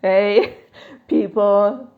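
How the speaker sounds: normal talking voice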